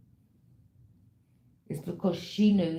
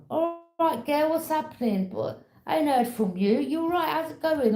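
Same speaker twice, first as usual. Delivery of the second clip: much higher, bright friendly caller; a friend ringing up, higher and louder, cheerful; cheery friend call; 10 semitones higher, 4 dB louder than usual. Retold or imitated. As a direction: play a friend checking in on the phone. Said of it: "people would call you".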